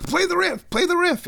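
very high-pitched urgent squeal; excited inner voice. Acted. Guitarist's brain urging him on as the riff comes up, excited high voice. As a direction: play an over-eager brain butting in, way up high. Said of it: "my brain will think ... I get really excited".

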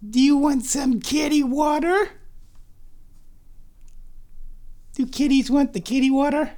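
high doting pet voice. Real, not acted. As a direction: coax the cat to drink. Offering water to cat.

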